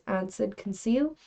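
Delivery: usual speaking voice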